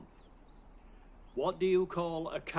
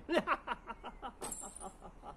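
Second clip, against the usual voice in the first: giggling laugh that ends soft; a high, delighted giggle trailing off; laughing at his own pun; 7 semitones higher, 8 dB quieter than usual. Real, not acted.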